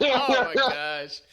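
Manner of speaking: high, slow, breaking into laughter; laughing. Real, not acted. Friend recalls their teenage bartending gig, laughing. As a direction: laugh through the words at the memory.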